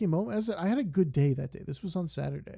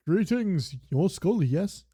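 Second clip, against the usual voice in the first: chirpy, loud, higher game voice; voicing a game character; 4 semitones higher, 6 dB louder, slower than usual. Game character voicing the NPC's greeting, higher and loud. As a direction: greet the player like a cheerful game NPC.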